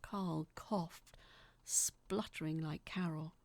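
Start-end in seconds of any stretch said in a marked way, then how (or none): none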